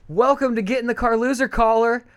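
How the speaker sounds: high, loud talk-show host welcome; radio host voice. Acted. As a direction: greet the caller like a DJ. Greeting caller like host.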